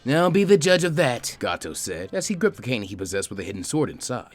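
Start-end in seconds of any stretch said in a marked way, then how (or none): 0.1-1.4 slow, raised, arrogant retort; a rich man putting a guard in his place; haughty boss. Acted. Gato snapping at the guard.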